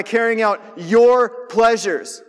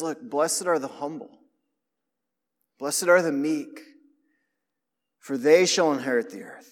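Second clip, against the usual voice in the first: gentle voice of Jesus; 5 semitones lower, 6 dB quieter than usual. Acted. Jesus quoted against the world.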